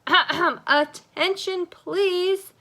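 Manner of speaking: loud, slow, high teacher call; attention-getting teacher voice. A narrator's reading. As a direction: call the class to order. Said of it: "in her most attention-getting teacher's voice".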